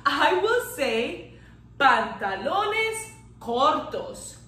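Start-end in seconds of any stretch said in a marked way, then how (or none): 1.8-4.3 "pantalones cortos" slowly, Spanish r and o; slow Spanish model. Shown as a demonstration. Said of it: "I won't say short pants".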